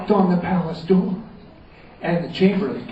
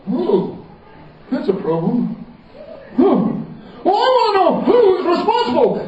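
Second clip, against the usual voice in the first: higher royal insistence; insistent king; 13 semitones higher than usual. Acted. The king insists on finding who is responsible. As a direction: demand the answer.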